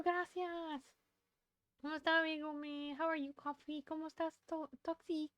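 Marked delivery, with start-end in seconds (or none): none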